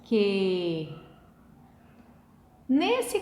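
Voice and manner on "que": "que" held as one long low syllable; low, lengthened "que", vowels merged into one; one single syllable. Shown as a demonstration. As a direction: stretch "que" out in one unbroken sound. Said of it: "uma sílaba só".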